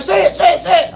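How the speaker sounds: high, loud, insistent chant; imitated begging fans. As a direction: egg someone on like an excited crowd. Crowd begging for his intro.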